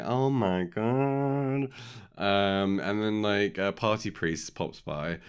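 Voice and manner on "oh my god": high, very drawn-out mock shock; slow hammed-up gasp of horror; imitated shocked onlookers. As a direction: stretch it out like a horrified bystander. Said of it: "lots of people, like, going".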